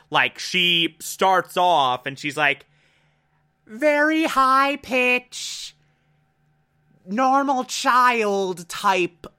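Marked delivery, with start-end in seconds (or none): 3.7-9.3 put-on much higher and slow, a kid's squeaky voice; hint of a child cartoon character. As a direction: lightly put on a little girl's voice. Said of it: "That's a bad impression".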